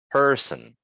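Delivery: "person" with the weight on er; stressed er. Shown as a demonstration. Teacher giving student a word to try, "person" with strong er. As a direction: press on the first syllable of "person", then drop. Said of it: "putting more emphasis on the er".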